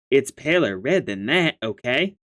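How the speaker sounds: ordinary delivery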